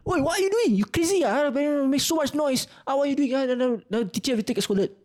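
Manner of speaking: high-pitched rant easing down at the end; retold scolding shout. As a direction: re-enact telling off a noisy classmate. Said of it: "I just started shouting at him".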